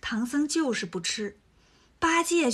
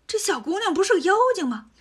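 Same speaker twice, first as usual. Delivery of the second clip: higher and quicker, startled; sudden realisation; 7 semitones higher, 4 dB louder, faster than usual. Acted. Monkey King spots the demon, startled and quick. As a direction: catch the demon out in a flash.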